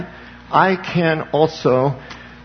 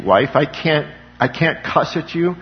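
ordinary talk

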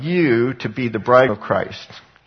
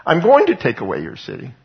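voicing God, rising; 6 semitones higher than usual. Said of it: "What God says is".

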